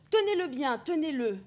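ordre crié, plus aigu et plus fort; voix de Damon. Acted. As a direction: crier l'ordre de tenir fermement. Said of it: "voix de Damon". (Damon ordonne aux robots de le tenir, crié)

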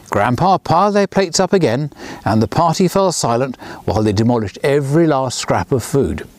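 ordinary delivery

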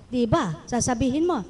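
normal talking voice

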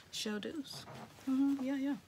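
usual speaking voice

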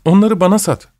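yalvarır gibi, daha ısrarlı; pleading. A narrator's reading. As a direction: plead for it now. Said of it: "sesini daha yalvarır gibi çıkarıyordu".

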